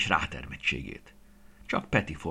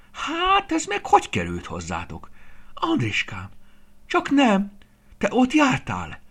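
startled, much higher and louder, a shocked grandmother; frightened; 8 dB louder than usual. A narrator's reading. Grandmother seeing the box her grandson brought, startled frightened cry. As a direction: gasp the words out in alarm. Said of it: "Ijedten lépett hátra".

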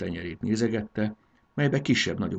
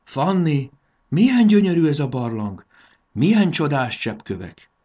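loud, high cry of wonder; amazed exclamation, soaring then falling; awed; 7 semitones higher, 9 dB louder than usual. A narrator's reading. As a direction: marvel out loud at a beautiful sight. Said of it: "Ámulva nézett körül".

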